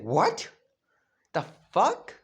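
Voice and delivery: loud, incredulous rising question; Sasuke's shocked disbelief. Acted. Sasuke seeing Naruto's eye change, shocked disbelief. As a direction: blurt it out in mock shock, pitch leaping up.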